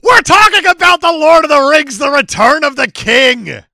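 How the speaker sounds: loud, high, thrilled announcing; excited shout. Real, not acted. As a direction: shout it out with glee. Excited announcement of next film.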